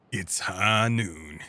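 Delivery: low, very slow, drawn-out catchphrase; lightly put-on low showdown voice; gunslinger catchphrase. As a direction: stretch each word slowly and low. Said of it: "No, that's not his voice".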